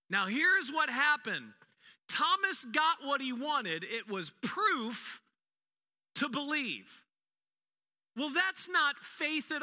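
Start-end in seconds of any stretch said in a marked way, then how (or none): none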